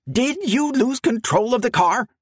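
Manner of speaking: high-pitched voice gone husky. A narrator's reading. President questioning the frightened motorman, high husky voice. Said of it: "high-pitched voice had grown husky".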